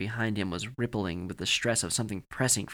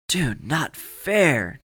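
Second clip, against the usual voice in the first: drawn-out, higher, louder complaint; balking protest; 5 semitones higher, 5 dB louder, much slower than usual. A narrator's reading. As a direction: stretch it out in indignant protest. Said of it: "Chance balked".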